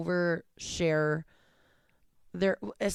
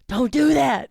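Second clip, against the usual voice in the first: loud disgusted retort; 4 semitones higher, 8 dB louder than usual. Retold or imitated. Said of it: "I'm like".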